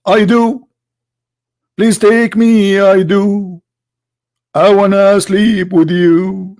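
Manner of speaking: high, slow, drawn-out swooning voice; mock lovestruck woman. Zainab answering the prophet's wooing, mock lovestruck. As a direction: put on a high, yearning woman's voice.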